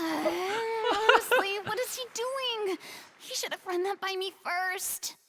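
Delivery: high-pitched huffy grumbling; exasperated complaint. Acted. Performer complaining about the surprise announcement, exasperated. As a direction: fret in indignation at being put on the spot.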